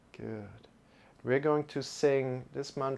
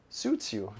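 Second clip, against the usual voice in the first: ordinary talk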